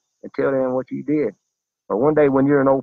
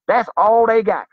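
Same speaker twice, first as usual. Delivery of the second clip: emphatic shout; 8 semitones higher, 5 dB louder than usual. Real, not acted. Emphatic repeated refrain.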